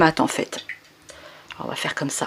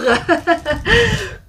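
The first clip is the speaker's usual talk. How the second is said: breathless laugh; 8 semitones higher than usual. Real, not acted. Laughs after heavy basket.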